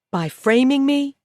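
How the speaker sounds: loud, high incredulous cry; outraged. Acted. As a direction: hurl it back in shock. Outraged at the framing.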